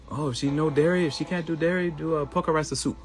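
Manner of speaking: put-on high lightly accented advice; imitating Chinese doctor. Doctor advising on the daughter's diet, mild Chinese accent. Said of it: "And he said".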